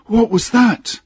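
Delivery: very high, loud startled question; alarmed cry. Acted. Engineer thrown to the deck, crying out in alarm. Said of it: "cried the engineer".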